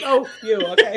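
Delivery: relieved little exhale with a laugh; retold relief. Co-host replaying relief that someone got her joke, relieved. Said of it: "And then I was like".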